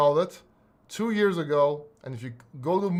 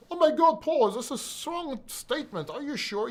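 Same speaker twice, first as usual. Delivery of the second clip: high-pitched mock-shocked doubter; incredulous viewer; 8 semitones higher than usual. Doubting viewer challenges Paul on his strong statement, mock incredulous. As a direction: put on a high voice questioning the claim.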